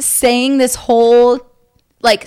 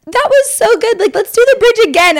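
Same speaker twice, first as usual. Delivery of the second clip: high excited quote, much higher; bubbly mock excitement, pitched way up; 9 semitones higher than usual. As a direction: imitate the star cheering the crowd on. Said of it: "And she's like".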